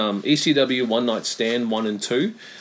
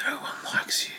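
whisper; 9 dB quieter than usual. Acted. Teasing taunt.

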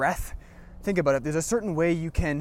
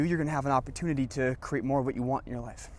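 ordinary talk, nothing marked

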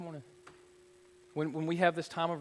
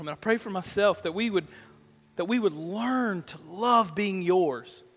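building from calm to loud, lifted praise; fervent pastoral prayer; 2 semitones higher, 5 dB louder than usual. Acted. Pastor praying over the congregation, swelling from calm to fervent. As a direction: pour it out to God, rising.

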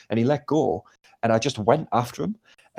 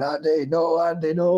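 put-on Darlington accent; 5 semitones higher than usual. Mate pleading to Andy, bad Darlington accent. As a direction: botch a Darlington accent on purpose. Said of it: "his Darlington ac-, I can't do it".

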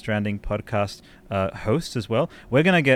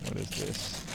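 whisper; 14 dB quieter than usual. Real, not acted. Opening a surprise package.

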